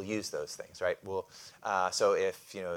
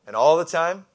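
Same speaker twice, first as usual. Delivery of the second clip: a pitched-up, ringing prompt; loud rising call; 7 semitones higher, 13 dB louder than usual. Real, not acted. Preacher prompting the congregation's response, high and loud. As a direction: call out and all the time, higher and louder, expecting an answer.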